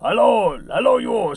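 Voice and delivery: high, loud mock Greek accent greeting; hammed-up Greek club owner's hello; bad Greek accent. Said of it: "that's my very bad accent".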